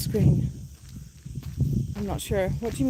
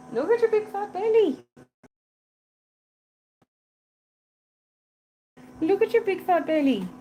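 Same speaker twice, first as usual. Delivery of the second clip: belly said much higher, cooing to the cat; 9 semitones higher than usual. Real, not acted. Keeper teases the cat about her belly, high cooing baby talk.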